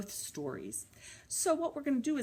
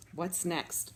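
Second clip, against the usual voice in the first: plain reading voice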